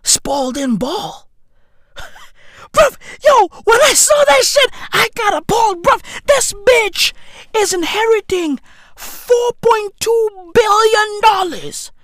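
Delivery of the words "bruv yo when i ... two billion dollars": outraged shout. Real, not acted. Raging to viewers about her billions, shouting. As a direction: yell the outrage out.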